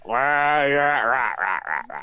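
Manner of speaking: mumbling around a pen; talking with pen clenched; pen in teeth. Real, not acted. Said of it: "I've got this dirty pen in my mouth".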